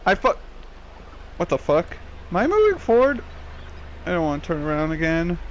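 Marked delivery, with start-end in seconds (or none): none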